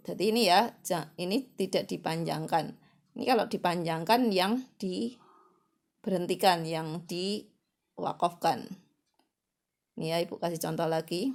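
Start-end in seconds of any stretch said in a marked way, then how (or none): none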